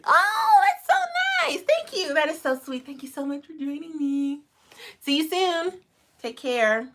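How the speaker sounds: high, bright thank-you to a viewer; excited greeting. Real, not acted. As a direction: gush thanks up high and loud. Greeting a viewer's kind comment.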